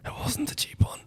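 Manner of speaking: barely voiced secret whisper; confessional whisper. Admitting to co-host the pricey bottle, guilty mock whisper. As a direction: leak the secret under your breath.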